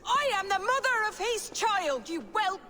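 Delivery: angry retort. Acted. Mother shouts back at son.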